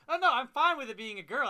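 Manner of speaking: loud, high-pitched imitated comeback; mock protest. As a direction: act out an exaggerated denial. Retold snarky comeback by Deadpool.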